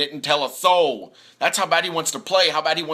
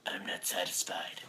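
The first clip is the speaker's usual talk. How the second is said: mock whisper, much softer, voice dropped low; 7 semitones lower, 13 dB quieter than usual. Luck never satisfied, said as a whisper. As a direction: breathe it out like a grim secret. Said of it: "he'd still be like".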